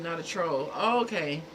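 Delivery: usual speaking voice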